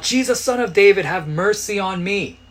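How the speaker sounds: high, loud cry for mercy; acted desperate shout. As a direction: shout it out over a crowd, begging. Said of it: "screaming at the top of his lungs".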